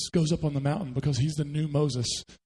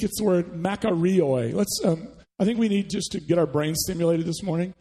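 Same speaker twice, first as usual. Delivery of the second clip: ordinary talk, nothing marked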